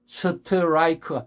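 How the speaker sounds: 스트라이크 slowly in five full syllables; wrong added vowels. Shown as a demonstration. Teacher showing extra vowels, slow Koreanized strike. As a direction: drag out every vowel of 스트라이크. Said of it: "어가 같이 붙어가지 않아요".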